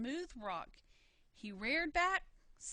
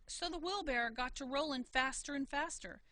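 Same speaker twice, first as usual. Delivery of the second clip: usual speaking voice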